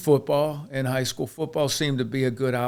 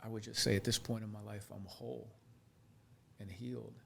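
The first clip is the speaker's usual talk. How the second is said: tearing up; 10 dB quieter than usual. Real, not acted. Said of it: "I feel like I'm tearing up".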